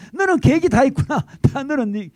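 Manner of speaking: high, loud repeated catchphrase; admiring exclamation. Retold or imitated. Father repeats the famous line, admiring and high. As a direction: quote the father in awe. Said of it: "감탄을 하죠".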